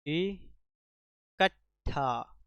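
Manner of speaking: इकट्ठा drawn out very slowly, each syllable apart. Shown as a demonstration. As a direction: stretch इकट्ठा out so students hear every sound. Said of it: "क्या कहते हैं हम लोग?".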